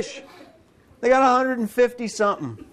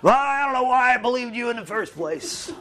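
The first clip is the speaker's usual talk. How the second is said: higher, sulky mock complaint with a laugh; grumbling disciple; 4 semitones higher than usual. Disciple grumbling at God, mock sulky and amused. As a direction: grumble like a let-down fisherman. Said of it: "Cursing God".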